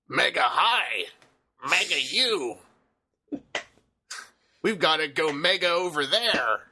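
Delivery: imitating a croaky cartoon frog voice. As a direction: squeeze it out like a little cartoon frog. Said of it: "that little frog voice".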